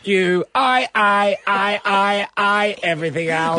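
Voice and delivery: high, looping robot stutter. Pretend. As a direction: repeat I like a stuck machine. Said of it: "you have to do a robot voice".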